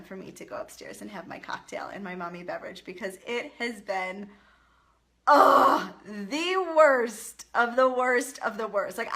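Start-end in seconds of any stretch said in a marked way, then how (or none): 5.3-9.0 exasperated. Real, not acted. Exasperated about a terrible day.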